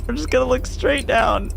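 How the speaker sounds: high, tight nervous voice. Real, not acted. Player steeling himself past a demon, nervous and high.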